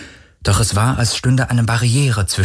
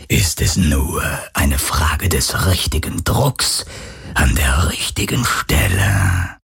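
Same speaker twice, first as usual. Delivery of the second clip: much lower, slow, menacing drawl of a ghost; unconcerned phantom; 5 semitones lower, slower than usual. Acted. The phantom threatens Kirai, low and unconcerned. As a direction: calmly savour the threat. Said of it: "sagte das Phantom unbekümmert".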